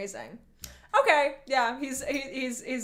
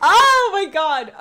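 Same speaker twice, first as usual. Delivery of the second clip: laughing; 7 semitones higher, 10 dB louder than usual. Real, not acted. Still laughing after the shriek.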